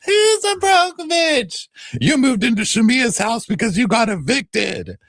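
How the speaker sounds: very high, loud, slow falsetto yell; hammed-up screaming match; mock shouting. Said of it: "Angela's like".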